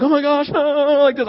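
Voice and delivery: high-pitched panicky imitation; mock freak-out. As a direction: act out someone freaking out. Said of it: "I'm freaking out".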